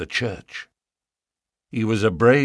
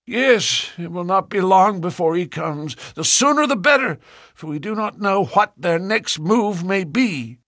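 put-on angry old farmer; 10 semitones higher than usual. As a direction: bark at the unwelcome suitors. Ferrier's voice held.

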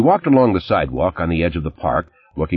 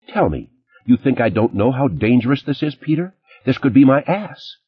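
harsh; 8 semitones higher than usual. A narrator's reading. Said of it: "She laughed harshly".

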